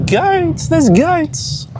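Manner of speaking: high, delighted "There's goats" at first sight; surprised. Real, not acted. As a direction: gasp it out, thrilled to see goats. Spots goats on mountain.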